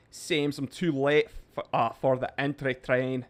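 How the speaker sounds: a touch of put-on Irish; light Irish accent. Streamer reading Seamus's chat comment in a light Irish accent. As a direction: read it out in a mild Irish accent. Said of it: "a little bit of an accent".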